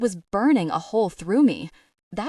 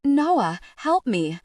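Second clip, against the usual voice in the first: high, loud panicked plea; cry for help; 5 semitones higher, 4 dB louder, slower than usual. Acted. Tegan begs Noah to help her, panicked. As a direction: shout for rescue in terror.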